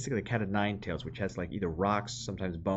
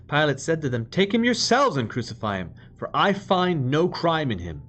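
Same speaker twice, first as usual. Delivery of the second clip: louder, measured reading of the Gospel; scripture reading; 3 semitones higher, 5 dB louder than usual. Acted. Preacher reads the Passion to the congregation, solemn proclamation.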